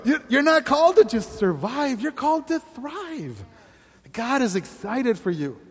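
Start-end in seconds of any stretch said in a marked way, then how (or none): 0.0-3.4 high, pressing appeal landing on "thrive"; urgent exhortation. Acted. Pastor pressing a weary person, high and urgent. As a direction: drive home "thrive". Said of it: "look at 'em and go".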